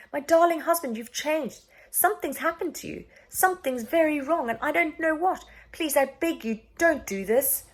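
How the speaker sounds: high, desperate begging wife; pleading. A narrator's reading. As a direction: beg him to stop. Queen begging the king.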